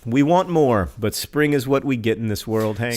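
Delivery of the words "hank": usual speaking voice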